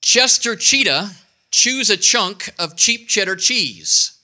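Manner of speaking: slow, lifted tongue twister; slow demo. Shown as a demonstration. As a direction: lean into every ch sound slowly. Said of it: "Here's a good one".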